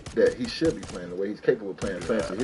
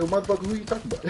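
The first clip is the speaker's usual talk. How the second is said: mock angry challenge; 7 semitones higher, faster than usual. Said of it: "So I said".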